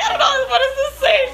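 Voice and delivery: very high, helpless blubbering; imitating your own sobbing panic; mock hysterical crying. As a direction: wail it in exaggerated tearful confusion. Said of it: "crying hysterically".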